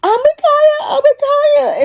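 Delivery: put-on high whiny kid wail; whining nephew. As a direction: whine like a little boy. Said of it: "and start fucking whining".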